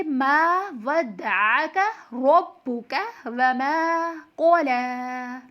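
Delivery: slow chant, long "मा", throaty अ, round र, thick "क़ला"; correct recitation. Shown as a demonstration. Teacher puts the rules together, slow recitation with long vowels and thick "क़ला". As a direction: recite slowly, stretching "मा" and the end of "क़ला". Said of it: "इसलिए हम पढ़ेंगे".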